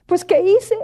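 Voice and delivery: oración afligida, más aguda, casi quebrándose; llanto recordado. Retold or imitated. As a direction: suplica al Señor con la voz rota. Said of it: "me solté llorando". (rezo entre lágrimas, tono alto)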